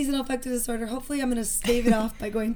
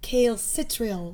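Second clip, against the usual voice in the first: slow, lightly Australian calcitriol; Australian accent; much slower than usual. Pretend. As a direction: try calcitriol with an Aussie twang. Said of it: "Can you say it in an Australian accent?".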